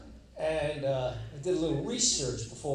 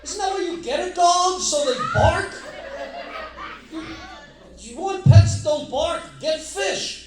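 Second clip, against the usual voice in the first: high, shouted comic outrage; loud incredulous rant; 13 semitones higher, 11 dB louder than usual. Real, not acted. Comic rants to the crowd about dog owners, shouting high. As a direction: yell it like it's obvious. Ranting at absent lady.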